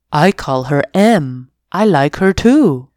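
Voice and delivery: lightly voiced dog Lance; a hint of a proud dog. Lance tells the kitten his favourite human, light dog voice.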